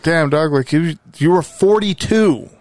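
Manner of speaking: normal talking voice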